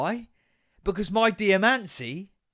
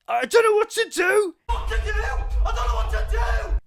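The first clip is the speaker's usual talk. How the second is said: very high, loud panicked shout; 5 dB louder than usual. Retold or imitated. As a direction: wail it out in total desperation. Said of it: "I was like".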